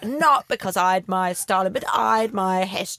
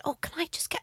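meek request; 7 semitones higher, 9 dB quieter, faster than usual. Retold or imitated. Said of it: "he went".

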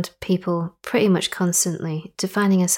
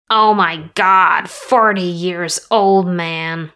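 mock shock; 6 dB louder, much slower than usual. Mock shock at queen's age.

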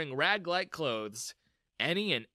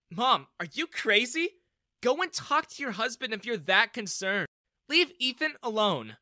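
scolding daughter; 8 semitones higher, 5 dB louder than usual. A narrator's reading. Daughter scolds her mother for hugging him, indignant.

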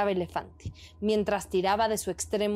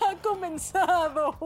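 a sly tortoise chuckling at the show; giggling tortoise voice; 8 semitones higher than usual. A narrator's reading. Tortoise giggles to herself watching the tug of war. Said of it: "la tortuga decía".

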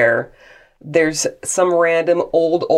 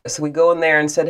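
normal talking voice

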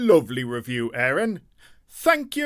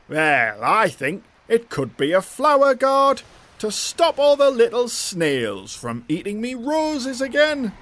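character voice; 4 dB louder than usual. Acted. Character voice, "me roses.

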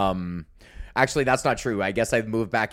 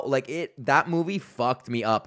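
ordinary delivery

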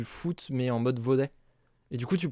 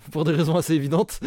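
loud, higher, through laughter; laughing; 3 semitones higher, 7 dB louder than usual. Real, not acted. Co-host joking about a banned sport, laughing. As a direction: say it while cracking up.

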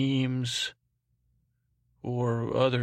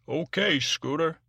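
higher-pitched put-on operator voice; imaginary phone operator; 5 semitones higher than usual. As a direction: pipe up as Bob the operator, higher. Host voices imaginary operator Bob.

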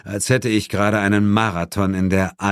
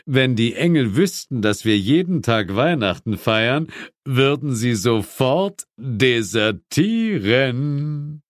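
lightly voiced demon, a touch higher; Asmodis voiced; 4 semitones higher than usual. A narrator's reading. Asmodis tells the mother, lightly voiced demon. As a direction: demon lord chatting at dinner.

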